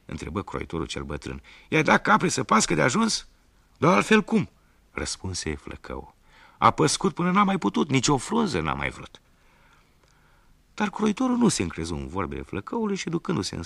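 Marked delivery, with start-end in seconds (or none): 1.7-3.3 high, loud demanding question; stern question. Acted. Tailor questions son. 3.8-4.5 high, loud indignant retort; indignant reply. Acted. Son answers defiantly. 6.6-9.2 high, loud insisting; insistent. Acted. Son insists to father.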